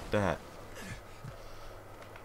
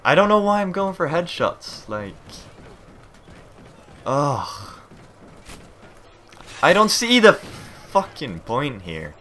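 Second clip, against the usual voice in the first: frustrated rant; 7 semitones higher, 7 dB louder than usual. Real, not acted. Frustrated gamer venting.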